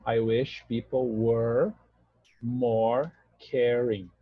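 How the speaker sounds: very slow, word by word; slow demo. Shown as a demonstration. Teacher spelling out the sentence slowly for students, slow and deliberate. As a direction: lay each word out slowly for the class. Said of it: "Ideia de presente, então verbo no passado".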